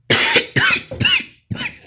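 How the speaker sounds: sudden harsh cough; loud cough. Real, not acted. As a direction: cough hard into the mic.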